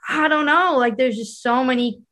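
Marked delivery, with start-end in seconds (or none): 0.1-0.8 higher, louder stumped quote; mock bewilderment. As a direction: play up having no idea. Acted bewildered reply, repeated.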